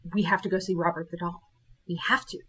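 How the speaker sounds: low, soft, quick urging; hushed insistence. Retold or imitated. Urging cruise friends to visit the doll, hushed insistence. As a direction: press the point quietly and intently.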